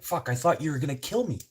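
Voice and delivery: high, loud relieved blurt; relieved outburst. Retold or imitated. Passenger confessing his fear to the driver, high and loud. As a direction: let the fear spill out in relief. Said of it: "He's like".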